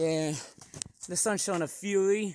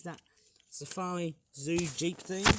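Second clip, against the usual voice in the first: ordinary talk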